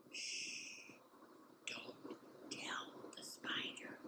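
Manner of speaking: soft unvoiced whisper; breathy secret whisper. Shown as a demonstration. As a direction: hush it like a secret. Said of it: "So you get to whisper it".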